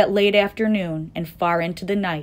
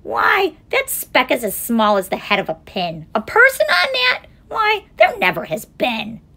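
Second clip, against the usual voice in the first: high, loud, scornful kangaroo; sour kangaroo; 7 semitones higher, 7 dB louder than usual. A narrator's reading. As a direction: scoff loudly in a raised voice. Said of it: "'Twas a sour kangaroo".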